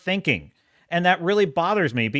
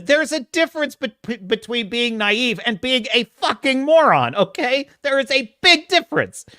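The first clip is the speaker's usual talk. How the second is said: high, loud ranting at the character; angry rant; 9 semitones higher, 4 dB louder than usual. Retold or imitated. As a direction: lecture the dumb hero furiously. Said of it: "And I'm like".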